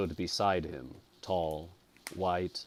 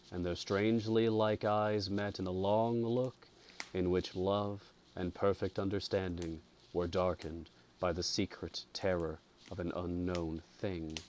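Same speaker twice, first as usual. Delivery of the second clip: ordinary delivery, nothing marked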